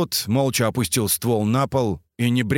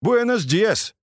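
put-on Mexican accent; 10 semitones higher, slower than usual. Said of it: "по-мексикански поздоровался скат".

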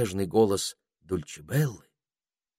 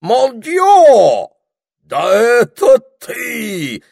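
put-on high, loud, drawn-out French-accented exclamation; хрипловатый французский акцент; 14 dB louder, much slower than usual. A narrator's reading. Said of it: "хрипловатым голосом с сильным французским акцентом". (с сильным французским акцентом, протяжно и громко)

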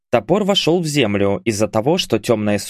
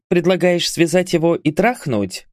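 smirking; 7 semitones higher than usual. A narrator's reading. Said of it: "усмехнулась Гара".

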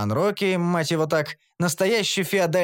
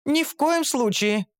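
much higher, slow, emphatic protest; fierce refusal; 12 semitones higher than usual. A narrator's reading. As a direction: forbid it outright. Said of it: "яро откликнулась Шантри". (Шантри яро протестует)

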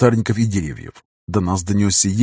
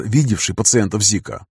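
ordinary delivery, nothing marked

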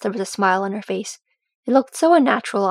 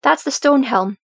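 higher, louder exclamation; astonished recognition; 4 semitones higher, 6 dB louder than usual. A narrator's reading. Dee spotting the leader of the host, amazed. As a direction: announce it in amazement.

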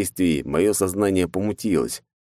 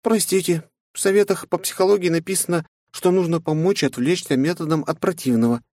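higher, lightly voiced apologetic; sheepish explanation; guilty Mai; 11 semitones higher than usual. Mai apologises guiltily to her master. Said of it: "виновато ответила она".

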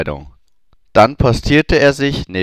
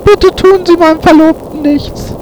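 pleading Hermine; 6 dB louder than usual. Acted. Hermine begs for fiancé.

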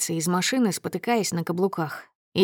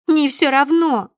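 loud, high, drawn-out contradiction; piercing shout; 7 semitones higher, 7 dB louder than usual. A narrator's reading. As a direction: shout down his indifference. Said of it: "Её голос становится пронзительным". (пронзительный возмущённый крик)

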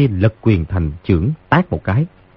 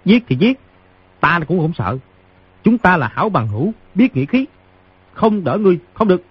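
put-on much higher child's voice, resolute; young boy; 9 semitones higher than usual. A narrator's reading. Boy refusing to abandon his wounded friend, high defiant voice. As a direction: pipe up high like a plucky kid. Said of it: "Đứa nhỏ nói".

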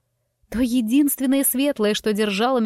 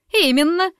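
a high, louder triumphant cry; triumphant exclaim; 8 semitones higher, 5 dB louder than usual. A narrator's reading. Priscilla triumphantly backs the crowd's accusation. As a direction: seize the point like a victory. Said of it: "торжествующе воздела палец Присцилла".

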